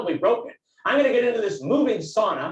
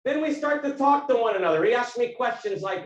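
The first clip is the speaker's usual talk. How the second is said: ordinary delivery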